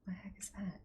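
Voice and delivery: unvoiced "the heck is that"; whisper. Real, not acted. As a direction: breathe it as a whisper. Whispered reaction.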